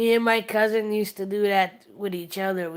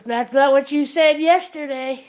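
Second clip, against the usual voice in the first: louder, higher, a teasing jab; cheeky retort; 5 semitones higher, 8 dB louder than usual. Retold or imitated. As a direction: land the punchline with a smirk. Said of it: "And Wade Brady goes".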